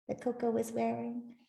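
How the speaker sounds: ordinary delivery, nothing marked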